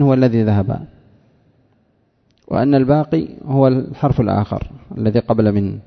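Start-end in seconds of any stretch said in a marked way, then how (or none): none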